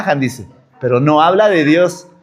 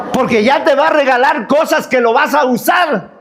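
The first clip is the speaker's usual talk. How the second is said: preaching shout; 7 semitones higher than usual. Real, not acted. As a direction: proclaim it to the whole church. Preacher proclaiming to the congregation. (grito de predicador)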